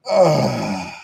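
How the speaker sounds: long groaned ugh; fed-up dad groan; exasperated groan. Retold or imitated. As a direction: let out a drawn-out groan. Exasperated at retold quarrel with son.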